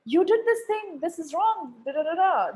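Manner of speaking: a mild imitation of a blaming customer; mock accusing client. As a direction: play the unhappy client pointing the finger. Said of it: "it was like".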